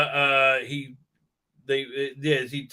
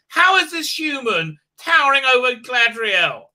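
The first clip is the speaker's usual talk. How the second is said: loud, high exasperated demand; indignant raised voice; incredulous outburst; 8 semitones higher, 7 dB louder than usual. Real, not acted. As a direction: shout it in disbelief. Exasperated outburst about the show.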